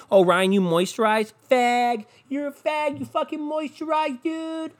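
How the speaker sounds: high, taunting jeer; mocking bully. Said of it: "when people make fun of me".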